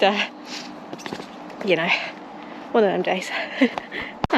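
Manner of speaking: normal talking voice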